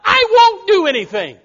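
high, loud, whiny brush-off of the warning; mock churchgoer. Churchgoer brushing off preacher's warning, high loud mock whine. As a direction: shout it like a carefree kid dismissing advice. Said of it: "And you say".

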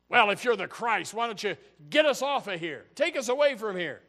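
the unrepentant thief taunting, loud; mocking thief. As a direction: jeer at Jesus to save them. First thief answers mockingly.

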